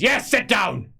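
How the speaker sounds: high, loud barked order; high, loud, impatient command; Tuberman, irritable. Acted. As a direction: snap at the boy to sit. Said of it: "Tuberman says".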